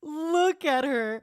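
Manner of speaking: high, drawn-out fangirl squeal; swooning, stretched-out coo; adoring gush. Real, not acted. Gushing over a character on screen.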